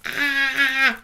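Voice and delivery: short high yell of frustration; demo scream. Shown as a demonstration. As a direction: let out a quick sharp scream. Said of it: "you'll find me screaming from the rooftops".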